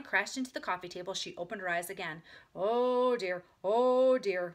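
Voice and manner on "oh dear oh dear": loud, drawn-out wheezy moan; moaning harmonica. A narrator's reading. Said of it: "moaned the harmonica".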